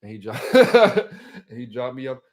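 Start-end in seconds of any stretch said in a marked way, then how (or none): 0.4-1.4 loud high burst of laughter; sudden loud cackling laugh; real laugh. Real, not acted. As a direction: crack up mid-story. Cracks up telling it.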